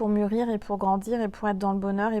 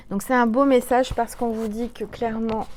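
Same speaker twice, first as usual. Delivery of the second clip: ordinary talk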